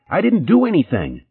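high, frightened protest; crying out. Acted. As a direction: plead innocence in panic. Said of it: "she cried".

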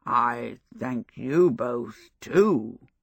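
low, slow, mushy voice of a stuffed scarecrow. A narrator's reading. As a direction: speak as if your mouth were full of damp straw. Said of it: "its mushy voice".

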